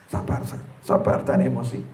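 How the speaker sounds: hushed whisper, talking himself down; whispered self-calming. Pretend. Husband soothing himself while his wife scolds, whispered. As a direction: breathe it out under your breath, barely voiced.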